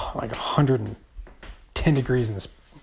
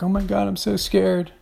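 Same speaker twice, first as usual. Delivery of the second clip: imitating scared sister; 8 semitones higher than usual. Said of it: "My sister's like".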